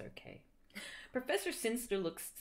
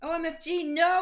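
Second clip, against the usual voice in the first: screamed; 7 semitones higher, 8 dB louder than usual. Acted. Heroine screaming as the floor opens, hammed-up. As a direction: wail it while falling. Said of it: "I screamed as I fell down".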